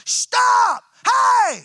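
sudden high, loud shout of stop; yell to stop them. Retold or imitated. Preacher yells at laughing students to stop. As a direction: bellow it to halt the whole room. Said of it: "but I can yell".

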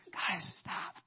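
whispered, mock pleading; whispered aside. Preacher teasing worship-leader friends, whispered plea. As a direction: hush them under your breath.

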